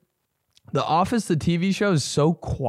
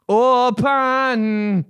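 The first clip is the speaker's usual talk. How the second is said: rock singer voice; 8 semitones higher, 4 dB louder than usual. Pretend. Punchline word stretched.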